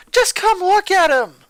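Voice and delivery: loud, high urgent yell; a girl yelling for her mom to come look. A narrator's reading. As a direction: shout for help from the kitchen. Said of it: "Ronaldo finally yelled out".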